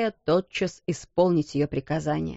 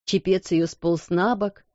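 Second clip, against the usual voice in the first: plain reading voice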